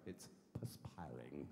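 whispered, refined perspiring; posh whisper. Shown as a demonstration. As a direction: breathe out It's perspiring like a genteel lady. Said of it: "I live in Hampshire".

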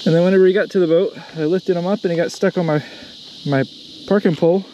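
panting, breathless and excited; out of breath. Real, not acted. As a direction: talk while catching your breath. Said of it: "I'm out of breath".

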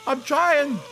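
flustered high panic. Real, not acted. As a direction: panic while fumbling the controls. Panicking, can't work the controls.

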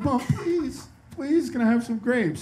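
hammed-up tearful child pleading; weeping child. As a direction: imitate a sobbing child begging. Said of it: "The kid's, like, weeping".

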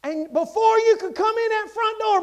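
usual speaking voice

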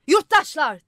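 high, loud call to the crowd; shouting. A narrator's reading. Freckled man calls out to the townspeople, loud. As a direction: hail the citizens at full voice. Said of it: "diye bağırdı".

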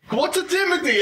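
puzzled high question. Acted. Player cuts in on spooky warning.

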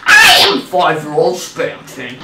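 Spamton. Acted. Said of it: "do my Spamton voice".

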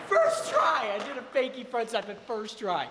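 high and loud with excitement; thrilled, bragging it out; excited. Real, not acted. Landed trick first try.

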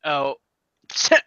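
usual speaking voice

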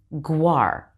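"guar" said slowly with a clear gw glide, as a model; gw sound. Shown as a demonstration. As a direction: stretch "guar" so the w after g is heard. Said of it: "when it comes after a G".

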